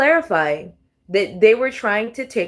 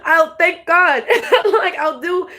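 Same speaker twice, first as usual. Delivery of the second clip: laughing; 9 semitones higher, 4 dB louder, slower than usual. Real, not acted. Laughs at her own joke.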